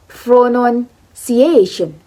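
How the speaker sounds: slow "pronunciation", split up, leaning on the A; stress on the A. Shown as a demonstration. Teacher explains word stress again, slow stress on the A. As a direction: draw out "pronunciation" as pronun-ci-A-tion. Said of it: "The word stress is in A".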